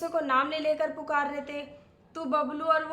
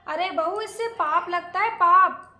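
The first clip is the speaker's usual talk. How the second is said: raised, reproachful warning, last word hit loud; scandalized scolding; 3 semitones higher, 7 dB louder than usual. Acted. Aunt scolds bahu over the sin, scandalized scolding. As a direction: scold with mounting outrage. Aunt scolds daughter-in-law about husband's tea.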